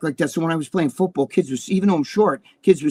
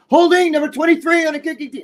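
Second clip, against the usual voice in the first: high-pitched mock referee call from dad; imitating father; 11 semitones higher, 4 dB louder than usual. Father yelling at the TV, imitated in a high voice. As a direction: mimic his father's indignant high call. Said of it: "his famous saying is".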